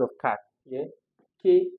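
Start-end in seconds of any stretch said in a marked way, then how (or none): 1.4-1.7 the K from cat, on its own; isolated K as a phonics example; K sound. Shown as a demonstration. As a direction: sound out K for a class. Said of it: "That's the sound".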